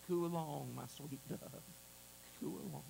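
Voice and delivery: soft, low, tender murmur; hushed, fond reassurance; tender coaxing. Acted. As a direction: gently coax a beloved little dove. God tenderly answering the cooing dove.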